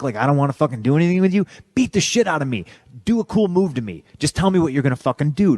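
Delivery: high, driving exhortation; impassioned pep talk. Retold or imitated. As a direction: retell it as an exasperated motivational rant. Retold backstage pep talk to rookie.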